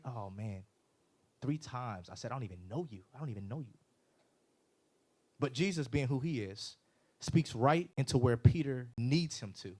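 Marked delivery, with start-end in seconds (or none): 0.0-3.8 very soft, downcast admission; imitating hushed remorse; acted shame. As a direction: let the voice sink into embarrassed regret. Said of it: "The hope and the shame".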